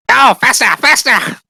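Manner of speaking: high, excited squeal to speed up; urgent rider's cry. Acted. Rat rider to the direwolf beneath him, urgent high-pitched cries.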